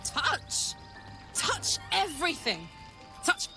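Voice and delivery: gleeful, rising high on everything; excited. Acted. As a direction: burst out with childlike glee. Gleeful list of what she'll do.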